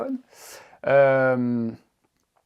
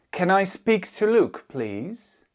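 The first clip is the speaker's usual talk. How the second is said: high, slow, with "please" rising at the end; rising intonation; 6 semitones higher, much slower than usual. Shown as a demonstration. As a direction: lift your voice on "please". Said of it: "l'intonation qui remonte". (intonation montante sur « please »)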